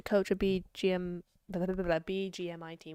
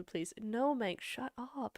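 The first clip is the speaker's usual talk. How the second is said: ordinary talk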